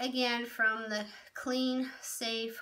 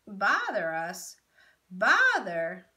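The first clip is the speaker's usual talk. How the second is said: cracking, louder outburst as the voice breaks; voice breaking; 8 dB louder, slower than usual. A narrator's reading. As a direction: let the voice crack on the word. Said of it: "the boy's voice broke".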